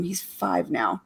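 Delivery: normal talking voice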